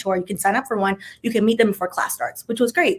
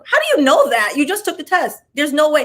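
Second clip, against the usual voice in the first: a hint of an incredulous dad, pitched up; dad disbelieving; 5 semitones higher than usual. Dad on the phone reassuring his crying daughter, mildly incredulous. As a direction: voice the dad who can't believe it.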